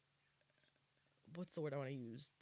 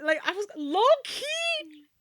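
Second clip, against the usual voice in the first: indignant squeal; 12 semitones higher, 10 dB louder than usual. Real, not acted. Felt attacked by celebrity.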